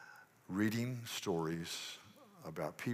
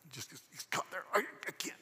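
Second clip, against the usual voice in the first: choking; 7 semitones higher, 3 dB quieter than usual. Pretend. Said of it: "like a chicken bone".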